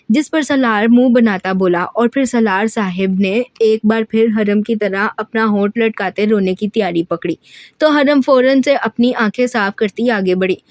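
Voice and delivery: ordinary delivery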